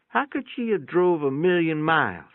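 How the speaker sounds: put-on a boy puzzled and a bit anxious; worried boy. A narrator's reading. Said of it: "he asked".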